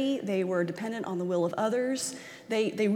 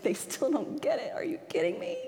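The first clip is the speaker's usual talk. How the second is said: incredulous, half-laughing exasperation; can't believe they still miss it; imagined exasperation; 5 semitones higher than usual. Acted. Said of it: "wondering what that made Jesus feel like".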